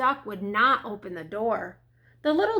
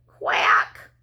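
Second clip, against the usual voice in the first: whispered, forlorn quack; small sad voice; 5 dB louder than usual. A narrator's reading. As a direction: quack weakly like a lost duckling. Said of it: "he heard a small, sad voice".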